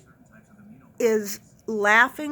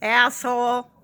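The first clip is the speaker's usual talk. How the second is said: mock holler; 4 dB louder than usual. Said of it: "she hollers out".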